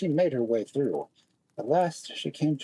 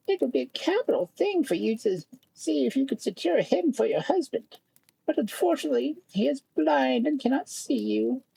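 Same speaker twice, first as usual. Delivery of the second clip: field mouse voice; 12 semitones higher than usual. A narrator's reading. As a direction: voice the kindly field mouse, pitched way up. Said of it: "One day the field mouse said".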